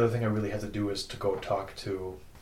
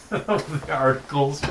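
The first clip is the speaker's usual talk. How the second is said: laughing; 5 semitones higher, 4 dB louder, slower than usual. Real, not acted. Player laughs at Russian's joke.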